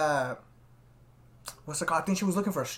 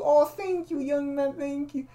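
higher, sugary thankful lady voice; sweet old lady; 8 semitones higher, slower than usual. Retold or imitated. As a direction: gush thanks like a sudden angel. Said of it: "she's an angel".

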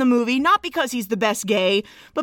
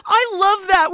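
ordinary talk

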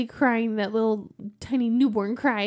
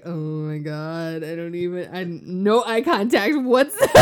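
lightly voiced dad who avoids eye contact; mortified dad; 5 semitones lower than usual.

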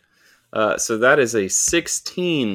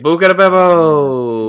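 slow, louder, mock-grand cry of the name; hammed-up exclamation; 3 semitones higher, 6 dB louder, slower than usual. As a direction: savour "Buca di Beppo" like a fake Italian fanfare. A drawn-out, hammed-up repeat of the name.

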